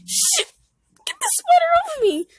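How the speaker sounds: high, fast, whispered panic; panicked whisper. Acted. Vel panicking at a spider on him, whispering high and fast. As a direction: hiss it out in a terrified rush. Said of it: "Vel is kinda panicking".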